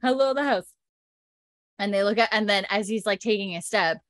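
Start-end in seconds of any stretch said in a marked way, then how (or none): 0.0-0.7 smaller, high-pitched hail; a half-strength holler to the house; calling out. Shown as a demonstration. Repeating the hail for co-host, lightly called out.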